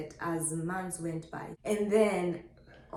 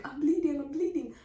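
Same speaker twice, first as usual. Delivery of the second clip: higher, repeated urgent announcement; mock-panicked repeat, pitched up; retold alarm; 8 semitones higher than usual. Pregnant woman coming home to family, retold alarm.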